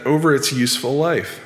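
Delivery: usual speaking voice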